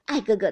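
mock-lisped 爱哥哥, loud and teasing; lisping imitation. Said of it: "偏是咬舌子".

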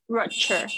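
"Rupture" with a clearly sounded R; audible R. Shown as a demonstration. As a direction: bring out the R in "Rupture" for the learner. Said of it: "有没有发现一个 R的声音". (示范"Rupture"里清楚的R音)

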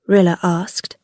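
plain reading voice

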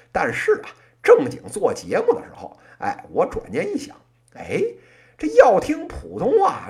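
usual speaking voice

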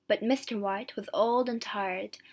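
usual speaking voice

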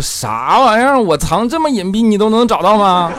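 high, loud outburst of disbelief; dumbfounded husband. Acted. Husband learns wife found his hidden money, stunned. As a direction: blurt it out in hammed-up shock. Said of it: "怪叔叔当时就懵了".

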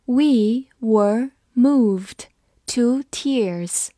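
We were moved drawn out word by word; slow reading. Shown as a demonstration. Teacher slowly reading the sentence for students. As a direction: read it slowly and clearly. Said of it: "我们慢读一遍".

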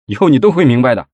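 impatient, emphatic retort; testy. A narrator's reading. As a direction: shut her questions down irritably. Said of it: "没好气儿".

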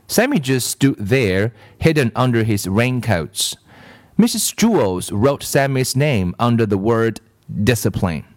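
plain reading voice

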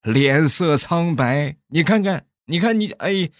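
put-on high, slow, tearful old uncle voice; old man Li pleading with emotion, voice high and slow; choked-up old man. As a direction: play an old villager choking back tears. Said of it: "李大爷哽咽着就说".

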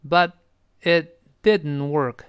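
slow "but", pause, "it didn't work"; slow dictation-style model of "but it didn't work"; word by word. Shown as a demonstration. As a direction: separate "but" from "it", each word clear. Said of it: "跟后面的it连读".

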